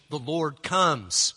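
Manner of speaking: The Lord comes, drawn out and firm; slow proclamation. Shown as a demonstration. Pastor demonstrates the proclamation, slow and firm. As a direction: announce it slowly and firmly. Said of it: "Maranatha can be a proclamation".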